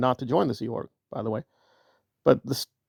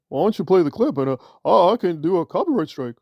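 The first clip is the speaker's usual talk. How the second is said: mocking Nora; 2 semitones higher than usual. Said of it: "Nora, because you and many other people".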